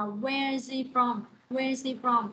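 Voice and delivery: "where is he from" run together as "where-zee from"; linked, silent H. Shown as a demonstration. Teacher telling students what they will hear, "he" without H in "where is he from". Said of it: "nó sẽ không đọc chữ H".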